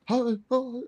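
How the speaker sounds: high, mock-baffled questioning; baffled reaction. Fan voicing everyone's reaction to wrestler, high mock bafflement. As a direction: squeak it in put-on disbelief. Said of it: "everyone's like".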